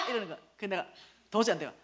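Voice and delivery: ordinary talk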